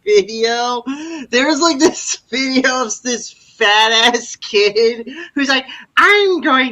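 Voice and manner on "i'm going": imitating a kid reviewer. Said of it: "why do you talk like that?".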